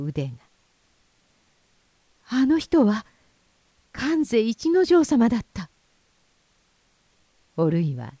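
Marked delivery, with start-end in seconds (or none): 2.3-5.7 high, breathless realization; はっとした気づき. A narrator's reading. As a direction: gasp it out as the truth dawns. おるいがはっとして気づく. (はっと気づいた驚きの声)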